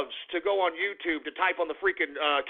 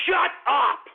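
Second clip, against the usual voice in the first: sudden higher-pitched yell; exasperated shout; 4 semitones higher, 3 dB louder than usual. Acted. Ranter silencing the imagined vlogger, exasperated shout. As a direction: cut off the babble with a shout. Cuts off his own vlogger parody.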